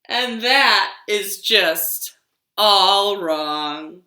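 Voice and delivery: a tree telling its seasons, slow and expressive; tree character. Acted. Librarian reading a picture story to toddlers, storytelling voice. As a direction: read it as the tree, savouring each season.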